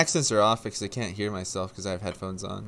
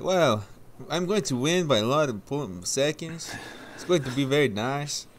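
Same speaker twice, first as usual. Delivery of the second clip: imitated interviewee, higher and lilting; Fraga; 6 semitones higher than usual. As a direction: put on a driver's interview voice. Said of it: "And he's like".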